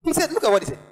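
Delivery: high-pitched, insistent sermon voice; fervent preacher hammering each phrase; emphatic preaching. Acted. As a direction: declare the scripture with urgent conviction. Preaches the scripture reading emphatically.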